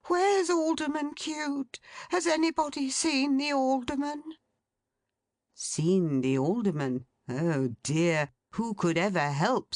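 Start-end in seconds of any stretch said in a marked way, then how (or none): 0.1-4.2 high, urgent, flustered questions; agitated asking. A narrator's reading. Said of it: "in great agitation".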